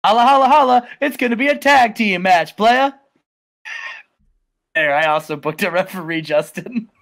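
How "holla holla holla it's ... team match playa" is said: Teddy Long. Retold or imitated. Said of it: "He says, he says".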